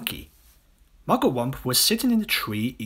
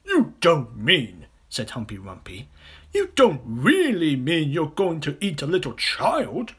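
higher, louder disbelief; aghast hippo; 5 semitones higher, 5 dB louder than usual. Acted. Hippo horrified at the crocodile's plan. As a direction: gasp it out in horror.

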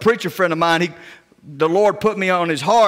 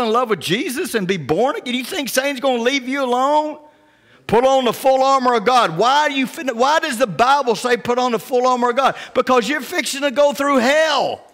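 fiery preaching; 7 semitones higher than usual. Acted. Preaching builds up.

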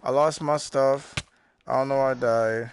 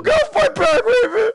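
high, loud mock-frantic yelling; hammed-up shout; 9 dB louder than usual. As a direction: shriek it like a playful meltdown. Mock meltdown while playing the game.

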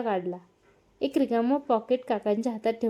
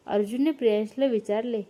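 ordinary delivery

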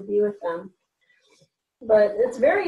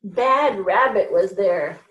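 ordinary delivery

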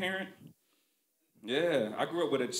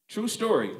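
ordinary talk

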